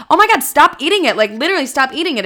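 high, loud, rushed command; mock-alarmed rapid telling-off; frantic retold scolding. Server to allergic customer still eating, frantic scolding.

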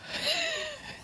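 short high squeal of laughter. Real, not acted. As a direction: burst into a squeaky giggle. Laughs at co-op pun.

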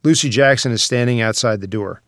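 ordinary talk, nothing marked

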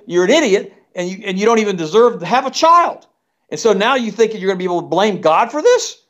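high-pitched, exasperated tirade; indignant outrage. Real, not acted. Indignant tirade at imagined parent.

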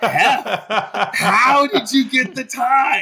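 high, loud, slow incredulous shout; shocked outcry. Retold or imitated. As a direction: shout it out in total disbelief. Her shocked outburst at Hef.

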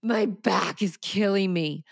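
drawn-out mock agony, high on the opening; over-dramatic complaint. Speaker replays own bathroom moan to mock it, over-dramatic complaint. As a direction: exaggerate the ache as if it were extreme. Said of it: "That was kind of extreme".

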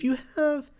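real accidental voice crack. Real, not acted. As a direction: voice suddenly cracks way up high. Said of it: "why did my voice just crack".

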